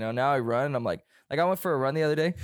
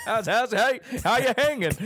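mock-friendly higher voice with laughter breaking in; cheery passer-by; 8 semitones higher than usual. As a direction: imitate a chirpy morning greeting, pitched up. Acted cheerful passer-by greeting.

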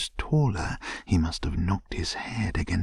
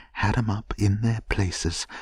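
ordinary delivery

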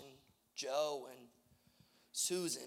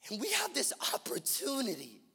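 fervent preaching; 7 semitones higher, 9 dB louder than usual. Real, not acted. Preacher urging the church toward a city in need, fervent preaching. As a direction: stretch the key words and build the appeal.

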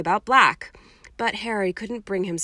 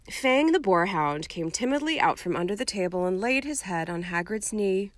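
ordinary delivery

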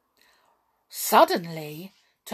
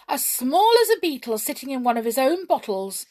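high, louder awed rush of words; amazed exclaiming; 7 semitones higher, 7 dB louder than usual. Acted. Goblin gushes over Hop's power, high and amazed. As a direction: marvel out loud, impressed and a little scared. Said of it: "he cried".

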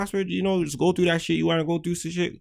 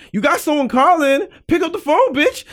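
high, loud nagging order; exasperated demand; 11 semitones higher, 7 dB louder than usual. Acted. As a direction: raise pitch and volume in mock exasperation. Acts exasperated order to answer.

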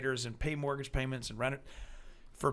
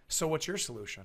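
pointed checkmate question; 3 semitones higher than usual. Retold or imitated. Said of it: "like a checkmate for anybody".

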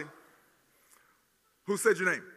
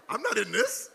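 laughing confusion; 9 semitones higher, 9 dB louder than usual. Retold or imitated. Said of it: "He was like".

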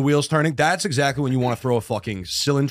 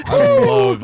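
excited shriek; 8 dB louder than usual. Real, not acted. Excited outburst.

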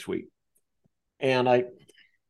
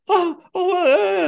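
much higher, wailing oh oh; imitated crying wail, much higher and louder; mock crying; 6 dB louder than usual. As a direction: break down at the news. Housekeeper hears she's going to prison.

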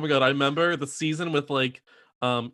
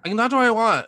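higher, louder defiant retort; mock defiance; 9 semitones higher, 4 dB louder, faster than usual. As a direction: act out a petulant comeback. Acted defiant retort in quarrel.

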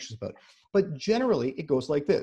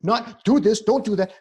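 loud, higher mock commands; raised-voice list of dos and don'ts; bossy coaching; 6 semitones higher, 7 dB louder than usual. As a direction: imitate a parent issuing orders. Mimics a parent coaching the child.

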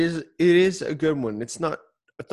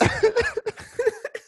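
high laugh. Real, not acted. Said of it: "no accent in real life".

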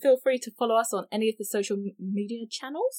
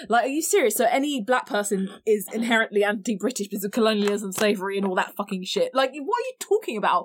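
incredulous outrage. Retold or imitated. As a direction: pile on the disbelief. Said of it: "And I was like".

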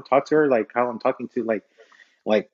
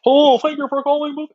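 high, loud put-on phone greeting; acting demo; 12 semitones higher, 5 dB louder than usual. Shown as a demonstration. Aspiring actor shows his range, high character voice. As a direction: show off a gushing caller-greeting character. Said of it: "or I can be like".

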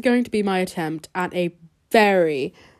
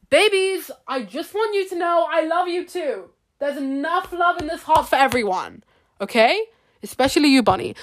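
cooing to toys; 8 semitones higher than usual. Real, not acted. Reassuring her stuffed toys that she loves them, high cooing voice. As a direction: coo to them like little babies.

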